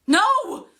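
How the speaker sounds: high, loud cry of alarm; sudden full-voiced shriek; scream. A narrator's reading. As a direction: scream it out to halt a killing. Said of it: "she screamed".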